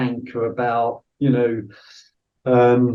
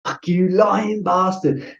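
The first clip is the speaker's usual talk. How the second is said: slow, higher, contemptuous imitation of the interrogator; retold angry accusation; 6 semitones higher, slower than usual. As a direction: spit out the insult slowly, in mock anger. Interrogator calls him a liar.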